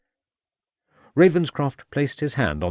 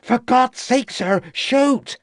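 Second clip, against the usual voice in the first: much higher, loud panicked cry; shouted; 5 dB louder, slower than usual. A narrator's reading. Constable under the dog shouting at the inspector. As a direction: scream for help under the dog. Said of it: "shouted the constable".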